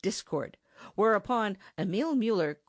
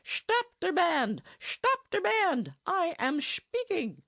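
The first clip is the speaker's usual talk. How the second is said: shouting over the band; 13 semitones higher, slower than usual. Acted. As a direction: yell to be heard above the noise. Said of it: "shouted in the effort to make himself heard".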